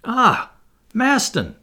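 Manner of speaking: high, loud exclamation of recognition; delighted-sounding high outburst; surprised cry. A narrator's reading. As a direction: call out on spotting a friend. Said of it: "cried Barbicane".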